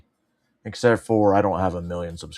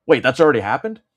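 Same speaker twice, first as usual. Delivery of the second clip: usual speaking voice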